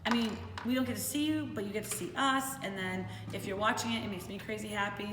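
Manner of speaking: normal talking voice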